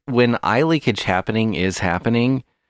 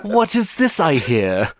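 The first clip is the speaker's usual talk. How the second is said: high, slow theatrical jester; hammy stage actor; 12 semitones higher, slower than usual. Pretend. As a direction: ham it up like a court minstrel. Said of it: "a jester costume or a minstrel costume".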